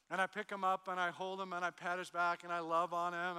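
usual speaking voice

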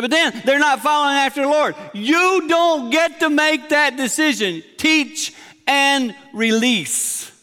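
Not your choice drawn out, slow and loud; forceful preaching. Real, not acted. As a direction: hammer it home from the pulpit. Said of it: "You're saying this very forceful".

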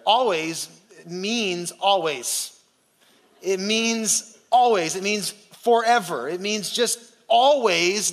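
slow, every always leaned on hard; drawn-out emphasis. Shown as a demonstration. Pastor explaining always to the congregation, slow and emphatic. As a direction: press each always slowly, like a teacher. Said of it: "I'm gonna go Greek on you here".

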